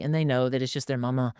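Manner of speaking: ordinary delivery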